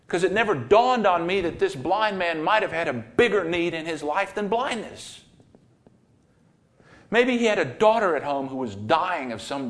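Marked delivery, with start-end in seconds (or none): none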